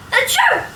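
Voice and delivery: high, loud fake sneeze. Reader sneezing as Sid in the story. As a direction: pop out a playful sneeze.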